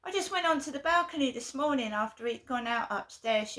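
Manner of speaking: ordinary talk, nothing marked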